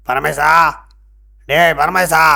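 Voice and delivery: loud searching call. Acted. Sage searching for his disciple, calling out. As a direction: shout for someone who is missing.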